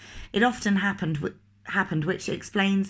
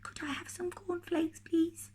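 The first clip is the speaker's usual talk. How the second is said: much softer, high small whisper; 11 semitones higher, 9 dB quieter than usual. Acted. Mister Quiet whispering his order to the grocer, very soft. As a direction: barely breathe the request. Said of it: "he whispered".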